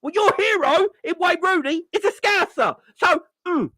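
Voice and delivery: high, laughing as he taunts. Real, not acted. Gloating at Mancunians about Wayne Rooney, high and laughing. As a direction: crack up while twisting the knife.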